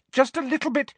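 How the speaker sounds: very high-pitched call; high, carrying reply; calling back. A narrator's reading. Hermione still calling back to Umbridge.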